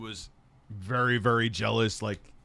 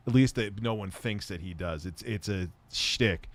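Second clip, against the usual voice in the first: usual speaking voice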